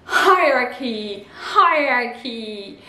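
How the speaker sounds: Hierarchy with a sounded H and a diphthong, hi-rar-ki, slowly; English hierarchy, slow. Shown as a demonstration. Teacher modelling hierarchy for the class. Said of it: "le H est bien prononcé".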